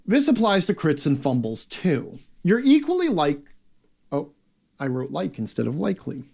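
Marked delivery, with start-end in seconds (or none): none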